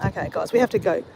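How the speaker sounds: usual speaking voice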